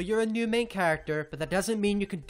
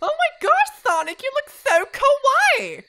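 high, loud squeal of delight; excited gushing; 6 dB louder than usual. Acted. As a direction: gush in a high voice, just after laughing. Gushing over Sonic's changed look.

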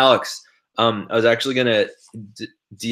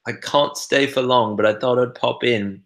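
higher-pitched British accent, imitating a chat friend; imitated British accent; 4 semitones higher than usual. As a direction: read it out in a put-on British accent. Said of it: "say it sort of in your voice".

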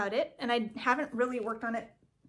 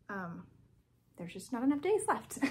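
normal talking voice

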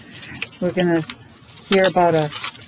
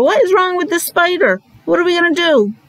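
put-on higher, playful fretting for the kids; exaggerated storytime concern; mock worry; 9 semitones higher than usual. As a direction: ham up being puzzled about the spider. Mock worry at silent spider.

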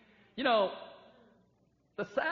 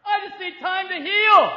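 mock wounded whine, rising higher and into a louder shout at the end; whiny hurt cry; 9 semitones higher, 11 dB louder than usual. Preacher mimicking a hurt believer who refuses to repent, hammed-up whining. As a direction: play the self-pitying hurt churchgoer, pleading. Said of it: "You hear this cry".

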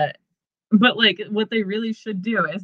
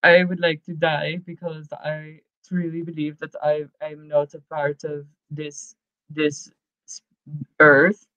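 mock gloomy Dead; 5 semitones lower, 4 dB quieter than usual. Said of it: "And I'll be Dead".

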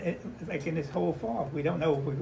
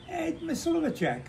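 high call across crowd; 14 semitones higher than usual. Retold or imitated. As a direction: sing out a name from afar. Secretary calling out his name.

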